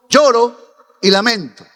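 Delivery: ordinary delivery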